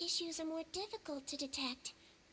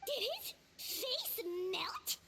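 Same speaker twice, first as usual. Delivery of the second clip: shocked disbelief; 5 semitones higher, much slower than usual. Acted. Shocked at melted face.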